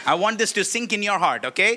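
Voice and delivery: usual speaking voice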